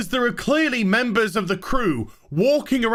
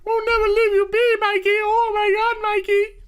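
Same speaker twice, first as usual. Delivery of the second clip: mock weepy crew; 12 semitones higher than usual. Ranter mocking the crew's teary vow to Mikey, high gushing wail. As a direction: ham up a blubbering pledge to Mikey.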